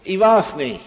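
re-enacted earnest, pleading denial; imitated sincere child's plea. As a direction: imitate a boy insisting on his innocence. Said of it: "das klang so echt".

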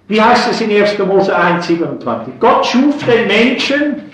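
loud, high impassioned preacher voice; fervent preaching. Acted. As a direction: preach it at full force from the pulpit. Preacher rising to passion.

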